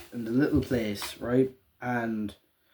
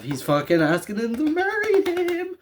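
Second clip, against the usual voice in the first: much higher, squealing with excitement; excited disbelief; 13 semitones higher than usual. Retold or imitated. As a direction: blurt it out in giddy shock. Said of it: "saying to myself".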